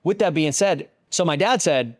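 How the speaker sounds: ordinary talk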